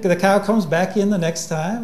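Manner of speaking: ordinary talk, nothing marked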